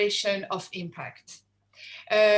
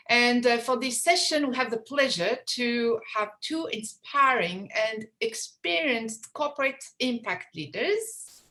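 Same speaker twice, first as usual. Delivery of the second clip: ordinary talk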